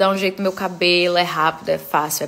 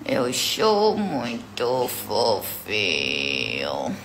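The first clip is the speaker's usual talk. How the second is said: much lower, very slow put-on dog voice; voicing the dog; 12 semitones lower, 5 dB quieter, much slower than usual. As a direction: speak for the puppy, deep and slow.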